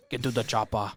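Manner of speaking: put-on Austrian accent; Arnold Schwarzenegger. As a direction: echo the action-hero line with an Austrian accent. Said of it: "sound like Arnold Schwarzenegger".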